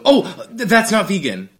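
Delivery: high, loud startled objection; knee-jerk shock. Retold or imitated. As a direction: blurt it out in retold disbelief. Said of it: "My knee-jerk reaction is to go".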